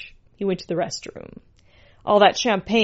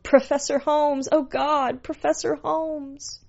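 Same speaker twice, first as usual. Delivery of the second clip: high-pitched yell held back in volume; restrained high ecstatic yelling; high cry; 8 semitones higher than usual. A narrator's reading. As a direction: cry out in mock climax, fading. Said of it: "The pet yelled".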